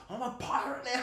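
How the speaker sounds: mock swagger. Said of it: "he's like".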